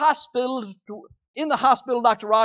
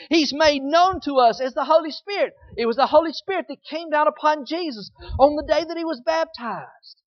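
full-force preaching; 3 semitones higher than usual. Acted. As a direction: preach it out to the congregation with conviction.

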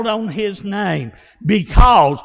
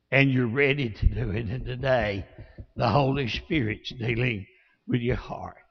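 low, soft pastoral appeal; altar-call invitation; 7 semitones lower, 7 dB quieter than usual. Real, not acted. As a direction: gently invite them to open their hearts. Leads altar invitation and prayer.

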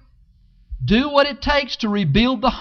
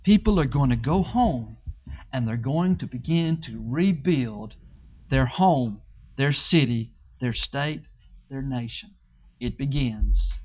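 quiet; 10 semitones lower, 7 dB quieter than usual. Acted. Dropping to intimate close.

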